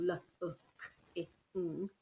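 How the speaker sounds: l, u, k, i, ng split into single sounds; one sound at a time; letter by letter. Shown as a demonstration. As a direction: sound every letter apart. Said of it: "'cause it's too long".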